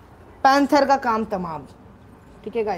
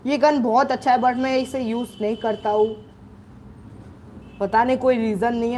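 usual speaking voice

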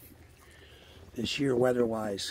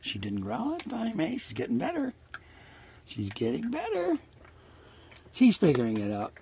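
pet talk; 8 semitones higher than usual. Real, not acted. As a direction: coo proudly about her progress. Pleased talk about the dog.

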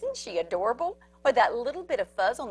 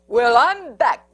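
loud, slow growl; bully dog returns with a growl; 9 dB louder, slower than usual. Acted. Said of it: "growled Big Bark".

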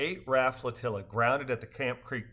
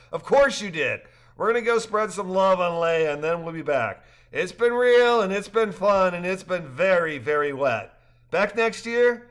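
higher, louder lively dialogue; cheerful characters; 6 semitones higher, 7 dB louder than usual. A narrator's reading. Rafters joking after the trip, higher and louder. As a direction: voice the rafters brightly.

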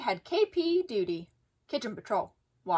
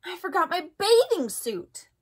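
dismayed cry; 7 semitones higher than usual. A narrator's reading. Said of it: "cried Froggy".

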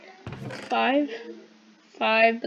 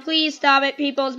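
loud, higher-pitched appeal; exasperated plea; 3 semitones higher, 8 dB louder than usual. Real, not acted. Player begging noisy people nearby, exasperated plea. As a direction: call out to make them quit.

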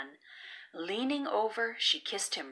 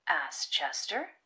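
usual speaking voice

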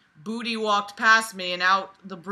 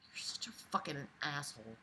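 breathy whisper, much softer; whispered insult; 5 semitones lower, 18 dB quieter than usual. Real, not acted. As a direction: mutter it under your breath in disgust. Muttered insult at the story's writer.

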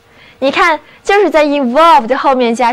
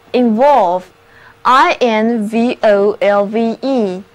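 slow spelled-out word; much slower than usual. Shown as a demonstration. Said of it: "就得到了这个新单词evolve".

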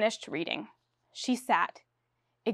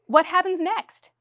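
eager question; 8 semitones higher, 4 dB louder, slower than usual. Acted. Director questions playwright.

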